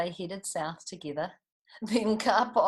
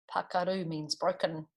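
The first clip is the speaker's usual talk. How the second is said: ordinary delivery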